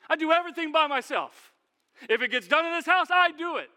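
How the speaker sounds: high, loud aggrieved whine; nobody helps me, pitched high and loud; entitled complaint. Acted. Martha griping about her sister, loud aggrieved whine.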